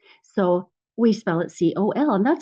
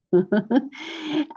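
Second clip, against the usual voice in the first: short laugh; 7 semitones higher than usual. Real, not acted. Teacher laughs at own example.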